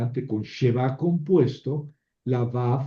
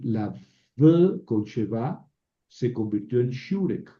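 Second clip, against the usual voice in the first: ordinary delivery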